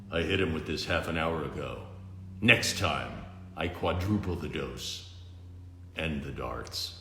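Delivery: low, soft dry hero voice; quiet deadpan; Batman's low voice. Acted.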